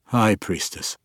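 a touch thinner, cowed; the old man repeating meekly; old drow giving in, slightly thinner voice. A narrator's reading. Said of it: "voice thinner".

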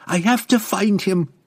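high, loud, strained plea; urgent insistence. A narrator's reading. Young woman blocked from searching for her friend, urgent. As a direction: insist forcefully. Desperate to leave and find friend.

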